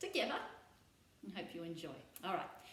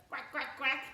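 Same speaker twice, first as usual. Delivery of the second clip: duck quacks; 2 semitones higher than usual. Shown as a demonstration. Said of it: "I'm gonna be a duck".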